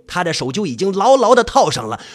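plain reading voice

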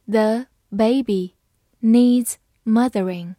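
slow, phrase by phrase; slow reading. Shown as a demonstration. Teacher reading slowly for students to follow. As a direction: read it slowly for the learners. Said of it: "好，慢慢来读。".

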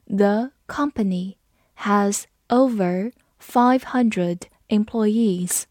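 slow, word-group by word-group with pauses; slow read-along. Shown as a demonstration. Teacher leading learners through the example sentence, slow read-along. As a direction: read it slowly and carefully for repeating. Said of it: "好，跟着我慢读一遍".

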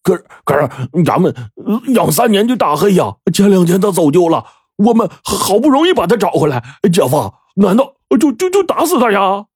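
high, stuttering protest; dismayed stammer. A narrator's reading. Dim henchman protests shooting the dog, stammering high. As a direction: plead for the dog, tripping on words. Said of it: "大傻一听傻了".

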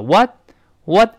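"What" with the t stopped, not released; tongue set for t in "What", no burst; unreleased final t. Shown as a demonstration. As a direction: cut "What" off on a silent t. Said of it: "失爆了".

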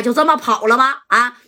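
ordinary delivery, nothing marked

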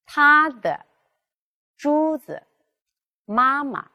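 first syllable high, second 的 子 妈 dropped light and short; neutral tone demo. Shown as a demonstration. Teacher modelling neutral tone after first tone for class, clear and careful. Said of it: "要读作半低调， 二度".

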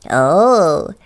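loud, drawn-out oh; a guard's long oh of recognition; sentry. Acted. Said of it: "said the sentry and smiled".

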